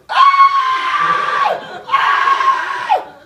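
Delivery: high shrieking Ah, laughing through it; panicked scream. Retold or imitated. Boy in the back seat screaming as brother falls out. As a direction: scream like a kid seeing his brother fall out. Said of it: "I'm sitting there going".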